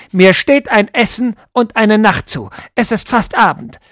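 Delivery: high, loud, rising demand. Acted. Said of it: "die Stimme immer lauter werdend".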